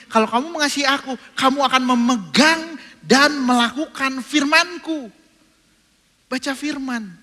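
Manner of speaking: high, loud fervent preaching; preaching at full force. Acted. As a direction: drive the point home to the crowd.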